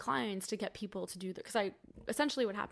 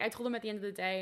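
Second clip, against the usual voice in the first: ordinary delivery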